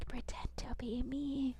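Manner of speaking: breathy unvoiced whisper. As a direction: hush it like a secret aside. Pretending the fiance is talking.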